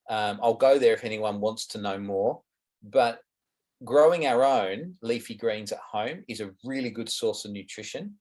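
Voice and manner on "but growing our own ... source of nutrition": ordinary talk, nothing marked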